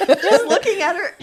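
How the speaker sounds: ordinary talk, nothing marked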